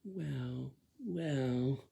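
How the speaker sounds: drawn-out, high mock "well... well"; each word held long, sliding down from high; silly way of talking. Shown as a demonstration. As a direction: demonstrate a silly, stretched-out voice. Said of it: "you don't go like this".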